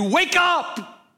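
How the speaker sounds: high, urgent preacher's yell; climactic shout. Real, not acted. Preacher urging the congregation out of apathy, climactic shout. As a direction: cry it out as the peak of an exhortation.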